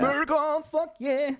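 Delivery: high hammed-up American cheer; mock patriotic cheer.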